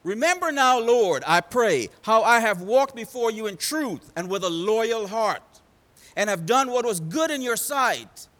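impassioned preacher reading a plea; Hezekiah's pleading prayer. Acted. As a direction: pray it out forcefully, phrase by phrase. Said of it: "this is what Hezekiah says to God".

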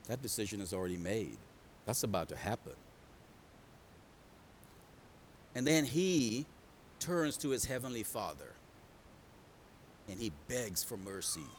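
low, soft, grave sermon delivery; quiet, solemn preacher; hushed preaching. Real, not acted. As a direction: bring it right down, almost confiding.

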